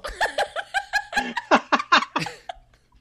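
long, loud, high-pitched cackle; hard laughing fit. Real, not acted. Cracking up at own story of the dance club, hard laughter. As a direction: burst into helpless real laughter. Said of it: "I shouldn't laugh so hard".

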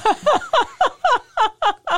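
loud, high burst of laughter; big laugh. Real, not acted. Laughing with the host at her own story. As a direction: let a real laugh burst out.

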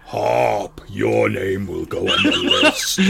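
loud, looming demon voice; demonic threat. Acted. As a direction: menace Harp like a giant fire demon. Demon face threatens Harp.

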